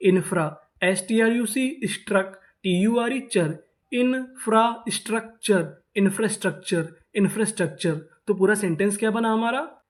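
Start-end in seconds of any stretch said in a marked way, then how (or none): 0.1-5.8 syllable by syllable. Shown as a demonstration. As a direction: sound out infrastructure in parts. Teacher sounding out new word.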